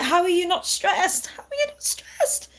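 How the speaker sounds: mock stressed disbelief; incredulous self. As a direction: squeal it in amazed disbelief. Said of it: "And I'm like".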